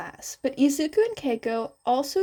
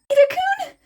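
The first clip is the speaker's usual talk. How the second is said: high squeaky yelp of surprise; startled high-pitched squeak; surprised squeak; 7 dB louder, faster than usual. A narrator's reading. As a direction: squeak his name, startled. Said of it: "Izuku squeaked in surprise".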